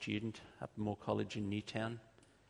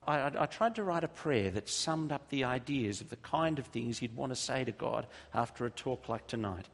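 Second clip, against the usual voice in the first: loud, raised preaching voice; fervent pastor lead-in to prayer; 4 semitones higher, 5 dB louder than usual. Real, not acted. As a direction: preach it out to the room with conviction. Preaching at full force.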